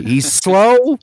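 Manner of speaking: high, loud, rising mimicry; amused, exaggerated echo of a friend; imitating a friend. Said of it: "how you said he's slow".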